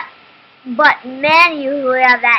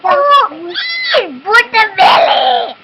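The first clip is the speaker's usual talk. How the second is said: excited shout; 8 semitones higher, 7 dB louder, slower than usual. Real, not acted. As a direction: blurt it out loud. Child interrupts excitedly.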